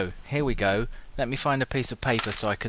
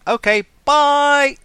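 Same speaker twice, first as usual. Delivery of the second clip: cheery bye; 10 dB louder, slower than usual. Real, not acted. Cheery sign-off shout.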